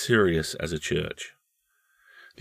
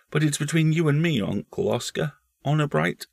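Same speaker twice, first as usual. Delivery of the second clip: put-on high, solemn child voice; grave small boy; 9 semitones higher than usual. Boy swearing his uncle to secrecy, grave. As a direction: confide it earnestly in a boy's pitch. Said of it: "said the boy gravely".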